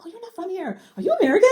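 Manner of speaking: high-pitched curious stranger, rising into a loud laughing question; hammed-up astonished question; mock surprise. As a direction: imitate a nosy stranger's amazement. Retold strangers hearing her accent.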